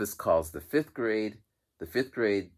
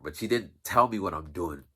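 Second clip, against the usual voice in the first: ordinary talk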